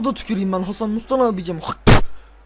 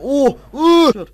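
excited cries; 7 semitones higher, 12 dB louder than usual. Real, not acted. Reacting to play.

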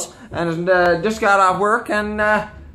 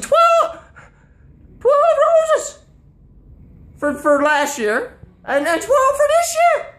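high, squeaky cry of disbelief; shocked disbelief; slower than usual. Retold or imitated. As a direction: shriek it as if the price is outrageous. Shocked by the roses demand.

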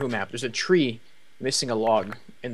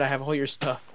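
normal talking voice

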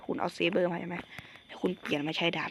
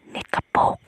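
sudden yell; 14 dB louder than usual. Real, not acted. Sudden yelled outburst.

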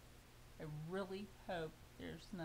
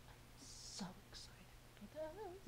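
breathless whisper, a little higher; excited whisper; 7 semitones higher, 11 dB quieter than usual. Real, not acted. Opening her fabric box, whispering how excited she is.

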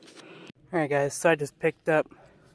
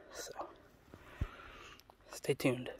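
whispering; 3 semitones lower, 14 dB quieter, slower than usual. Real, not acted. Sign-off to viewers, whispered.

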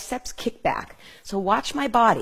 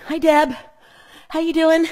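bright greeting; 7 semitones higher, 5 dB louder than usual. Real, not acted. Greeting live viewer.

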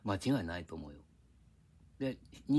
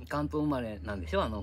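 normal talking voice

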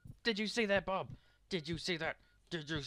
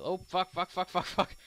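loud, high burst of cursing; panicked swearing; 8 semitones higher, 9 dB louder, slower than usual. Real, not acted. Drilled into patient by mistake.

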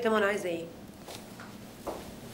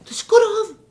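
loud, high-pitched astonishment; scandalised, raised-voice incredulity; shocked disbelief; 11 semitones higher, 10 dB louder than usual. Acted. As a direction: exclaim in shock, voice leaping up fast. Nurse shocked he would thank attackers.

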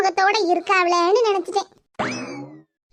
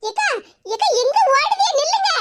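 high, urgent call to stop; pleading, pitched-up holler; calling after someone; 7 semitones higher than usual. Acted. Calling after a fleeing woman.

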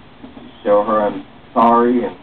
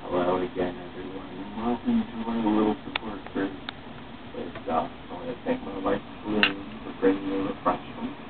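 soft group leader; 3 semitones lower, 12 dB quieter than usual. Acted. Support group leader speaking.

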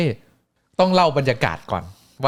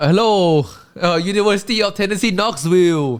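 higher, drawn-out announcer call; arena announcer; 7 semitones higher, 3 dB louder, much slower than usual. Retold or imitated. Announcer greeting the Knoxville crowd, drawn-out and higher.

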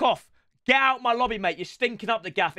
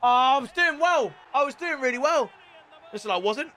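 normal talking voice

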